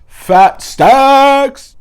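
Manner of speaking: hyped-up flex with a yell; money brag. Acted. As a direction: holler it like a rapper flexing cash. Bragging about a fat wallet.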